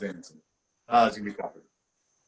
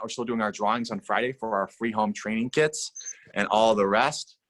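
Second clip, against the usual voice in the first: normal talking voice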